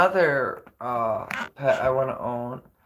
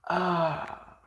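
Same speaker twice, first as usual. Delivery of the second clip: usual speaking voice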